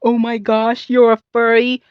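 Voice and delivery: high, loud mocking gasp of judgment; mock accusation. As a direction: imitate a scandalized accuser. Mocking people who accuse her.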